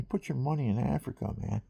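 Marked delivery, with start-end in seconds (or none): none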